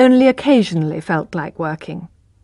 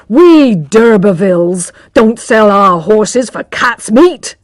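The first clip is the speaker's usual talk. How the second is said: louder, slow, lordly declaration; proud insistence; 7 dB louder, slower than usual. Acted. Proud father refusing to sell the horse, slow and loud. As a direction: stand on your noble dignity. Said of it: "he insisted".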